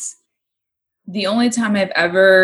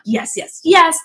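retold giddy yeses, the last one bursting out; excited; 10 semitones higher, slower than usual. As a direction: act out mounting excitement. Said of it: "I get all excited".

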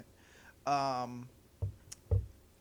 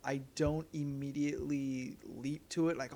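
ordinary talk

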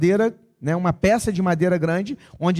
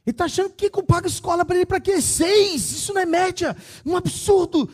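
pai furioso; 11 semitones higher than usual. Retold or imitated. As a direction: explode at the report card like a furious father. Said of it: "ele ficou irado com aquilo". (pai furioso gritando, bem mais agudo)

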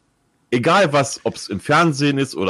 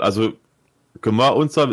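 normal talking voice

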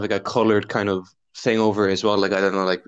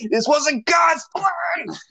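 high, slow hammed-up shout; mock scream; much slower than usual. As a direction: wail it to the heavens. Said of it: "I scream".

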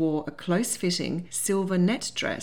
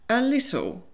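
higher, understated admission; sheepish; 6 semitones higher, 3 dB louder than usual. A narrator's reading. Suitor admits to losing weight, sheepish. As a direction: own up a bit sheepishly. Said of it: "he replied sheepishly".

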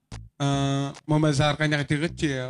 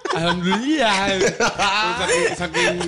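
laughing; 3 semitones higher, 4 dB louder than usual. Real, not acted. Laughing over saying alhamdulillah.

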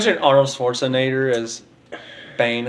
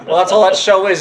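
ordinary talk, nothing marked

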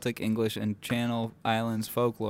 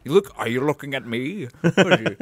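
slurred impression of a swaggering film hero; tough-guy challenge. Imitating the film hero squaring up to a dead tree, mock-menacing. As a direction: pick a mock fight with a tree. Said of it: "He's like".

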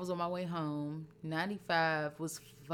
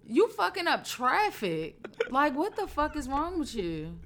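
much higher, loud angry scolding; furious rant; 11 semitones higher, 6 dB louder than usual. Real, not acted. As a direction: yell at the trooper for wasting time.

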